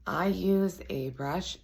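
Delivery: ordinary talk, nothing marked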